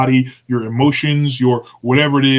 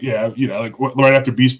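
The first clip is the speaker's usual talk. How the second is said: ordinary talk, nothing marked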